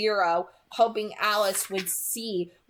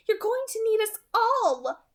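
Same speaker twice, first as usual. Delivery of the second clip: Alice cautioning; 12 semitones higher than usual. A narrator's reading. Alice warning Edward to stay with them, high and firm. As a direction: warn him in Alice's high voice. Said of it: "Alice cautioned".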